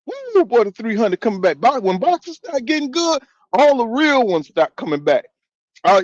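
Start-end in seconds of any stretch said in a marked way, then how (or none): 0.1-0.7 high delighted whoop; an excited whoop. Real, not acted. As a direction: whoop with joy at the returning fans. Greeting returning viewers.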